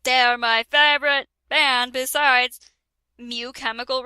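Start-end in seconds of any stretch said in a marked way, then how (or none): none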